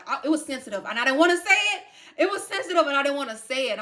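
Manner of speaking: very loud, high-pitched protest; real upset, bursting out loudly; distressed outburst. Real, not acted. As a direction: cry it out, voice shooting up. Upset outburst over death card.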